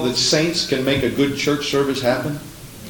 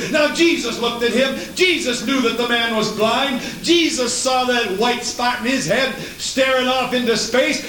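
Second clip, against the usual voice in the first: high-pitched, driving sermon delivery; impassioned preaching; 10 semitones higher than usual. Acted. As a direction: preach at full force, pitch held high through every plea. Preaches at full force.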